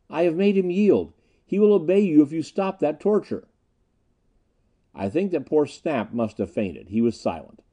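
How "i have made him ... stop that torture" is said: high, projected call; shouting. A narrator's reading. Prince shouts to Miko that Haljan will obey, urgent. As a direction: call it across to someone far off. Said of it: "Prince shouted".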